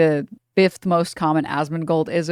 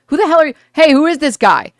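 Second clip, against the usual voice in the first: higher, louder mock yell at a stranger; imitating protesters yelling; 9 semitones higher, 7 dB louder than usual. Protesters confronting an agitator, shouted mock yell. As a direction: call out who the hell this guy is. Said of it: "the actual protesters are there like".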